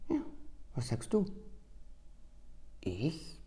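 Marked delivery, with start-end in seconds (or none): none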